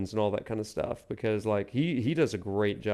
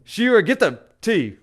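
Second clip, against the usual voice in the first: put-on good old Southern accent, higher and louder; showing off a Southern accent, raised and loud; 8 semitones higher, 7 dB louder than usual. Shown as a demonstration. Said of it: "I can turn on that good old Southern accent too".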